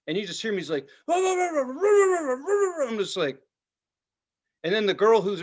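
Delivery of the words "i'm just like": high, drawn-out imitated sob; stretched wail of a kid in tears; mock crying. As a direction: whimper it like a crying teen.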